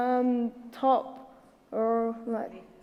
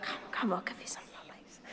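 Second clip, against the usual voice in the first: soft secretive whisper, much softer; nan's whisper; 4 semitones lower, 14 dB quieter, faster than usual. Retold or imitated. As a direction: whisper like a nan sneaking you sweets. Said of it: "the type of nan that will be like".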